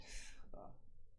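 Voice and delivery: a soft, hushed chuckle; whispered laugh. Real, not acted. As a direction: laugh under your breath. Streamer laughs at chat.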